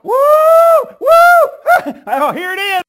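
high, loud whoops of victory; jubilant shout. Acted. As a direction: shout for joy like a crowd that just got its weapon. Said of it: "All Israel did what?".

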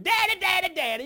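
put-on begging child. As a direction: son begging his daddy for the cheap glove, high and insistent. Child begs father repeatedly.

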